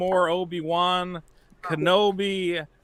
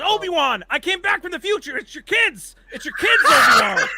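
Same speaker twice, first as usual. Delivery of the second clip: high, rising to a laughing shout; hammed-up panic, growing louder to the end; a parody Qui-Gon yelling about the kids; mock frantic Qui-Gon; 11 semitones higher, 5 dB louder than usual. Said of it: "he goes".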